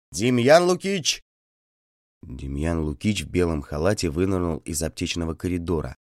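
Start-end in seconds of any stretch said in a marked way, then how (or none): 0.1-1.2 loud, much higher yell. Acted. Doctor yells for the feldsher, a loud high shout. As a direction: shout for help down the corridor. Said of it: "и завопил".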